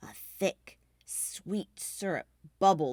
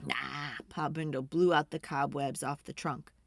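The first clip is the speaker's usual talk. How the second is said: plain reading voice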